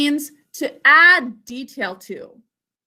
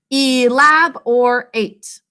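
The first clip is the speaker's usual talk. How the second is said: ELABORATE slowly in four clear syllables, E-LAB-OR-ATE; sounded out; much slower than usual. Shown as a demonstration. Teacher repeats the sounding out with class, ELABORATE slow and syllabified. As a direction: draw out each syllable of ELABORATE evenly. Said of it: "Let's try that again".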